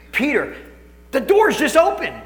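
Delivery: high, loud and amazed; excited storytelling. Real, not acted. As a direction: tell it with rising wonder. Excited retelling.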